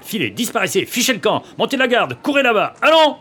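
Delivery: angry orders building to a high shout; furious dismissal. Acted. Young man driving his rival away, furious.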